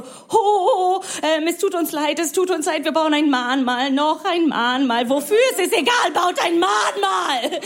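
high, hammed-up wailing apology; over-the-top high-pitched contrition; mock remorseful Germans. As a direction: wail in exaggerated guilt, building to a frantic cry. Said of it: "ihr habt so Erinnerungskultur".

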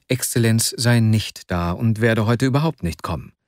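usual speaking voice

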